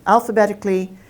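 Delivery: ordinary delivery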